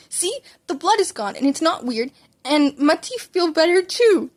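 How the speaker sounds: put-on high, eager little boy; child voice, thrilled and loud; excited child. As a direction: show off proudly to Mom. Child showing off to mom.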